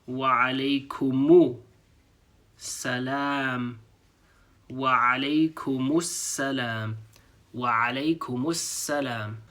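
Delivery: Wa alaykumus salam slowly, linked together; slow demo. Shown as a demonstration. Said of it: "Now we're saying".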